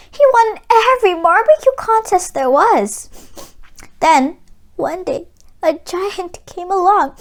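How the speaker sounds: a lightly played widow close to tears; grieving mother. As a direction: tell it like the loss still stings. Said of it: "dabbing at her eyes".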